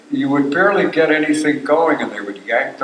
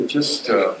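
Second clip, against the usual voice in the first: normal talking voice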